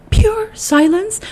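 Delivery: high, drawn-out disbelieving question; incredulous delight. Real, not acted. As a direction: stretch it out high in wonder. Marvelling at the idea of silence.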